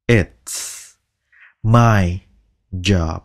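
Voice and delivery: "It's", "my", "job" spoken one word at a time. Shown as a demonstration. Teacher slowly splitting the phrase into words. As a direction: space out each word. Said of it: "chỉ xì ra cái thôi".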